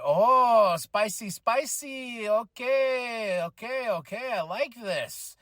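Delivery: drawn-out relish, rising in pitch then settling; acted intrigued delight. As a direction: savour it with playful, hammed-up approval. Acts own delighted reaction.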